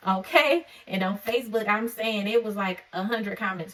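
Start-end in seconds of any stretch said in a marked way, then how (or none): 0.1-0.7 sharp, loud high okay; calling the crowd to agree; emphatic okay. Real, not acted. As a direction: punch it up to grab them. Preacher presses her point home.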